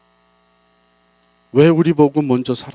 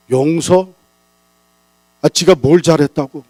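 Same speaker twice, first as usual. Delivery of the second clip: sulky grumbling; 5 semitones higher, 7 dB louder than usual. Acted. Proud person grumbling, voiced.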